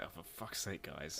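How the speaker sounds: very soft, low exasperated aside; quiet, resigned complaint. Retold or imitated. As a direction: grumble it under your breath. Retold exasperation at vaccine wait.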